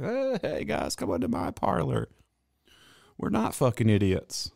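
high, soft mocking salesman pitch. Vlogger mocking online self-promotion, in a soft high salesman voice.

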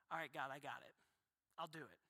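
low, soft, resigned prayer; quiet surrender. Acted. Believer finally yielding to God, low and soft. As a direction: give in quietly, almost to yourself.

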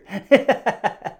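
loud burst of real laughter. Real, not acted. Podcaster cracking up at his own uncle story, loud laugh. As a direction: crack up at your own gag.

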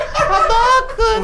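slow, high cracking game shout; voice cracking up an octave. Real, not acted. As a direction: stretch the yell until it cracks. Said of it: "Why did you do that?".

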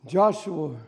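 quietly imagine Joshua's grief; hushed preacher narrating Joshua; hushed storytelling sermon. Acted.

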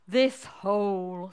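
slow, drawn-out mole voice; sad mole. Acted. Sad mole tells frog about the hole, slow mournful voice. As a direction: stretch the words out mournfully. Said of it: "mole who was looking quite sad".

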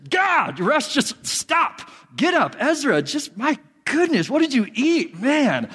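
put-on high, exasperated parent shouting at kids; frustrated yelling. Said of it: "get mad and get frustrated".

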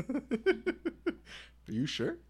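high laughing squeal of disbelief. Real, not acted. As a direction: laugh it out, barely believing. Laughing disbelief at pizza price.

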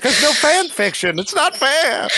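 high, hammed-up lament over lost fan fiction; dramatic whine. Teasing the librarian friend, mock tragic whine. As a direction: wail it like the world ended. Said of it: "I was just trying to be dramatic".

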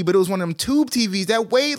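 ordinary talk, nothing marked

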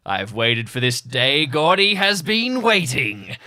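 slow, loud villain threat; drawn-out threat to his rival; menacing villain voice. Acted.

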